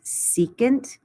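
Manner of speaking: secant said SEE-kant; careful secant with a long e; pronunciation of secant. Shown as a demonstration. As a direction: say secant slowly, rising at the end. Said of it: "This one is pronounced".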